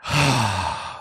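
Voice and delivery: long audible sigh out through the mouth; demonstration sigh. Shown as a demonstration. To the listener, showing how he regulates, a slow releasing sigh. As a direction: let the breath go in a slow sigh. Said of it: "I even let it out like a sigh like that".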